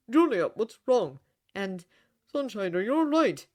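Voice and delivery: rising alarm in the voice; distressed. A narrator's reading. Father pleading with sobbing daughter, loud distressed worry. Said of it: "in increasingly distressed tones".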